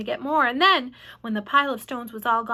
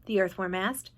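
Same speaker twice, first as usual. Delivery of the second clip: ordinary delivery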